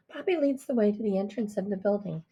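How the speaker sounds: ordinary delivery, nothing marked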